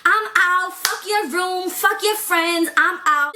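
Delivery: high, huffy flounce-out voice; dramatic exit. Retold or imitated. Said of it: "trying to do a dramatic escape".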